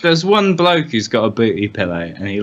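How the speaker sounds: usual speaking voice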